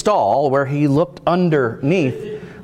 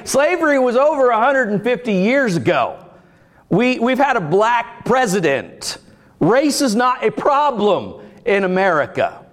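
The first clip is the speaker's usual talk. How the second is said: dismissive skeptic; 9 semitones higher than usual. Retold or imitated. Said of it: "people who say".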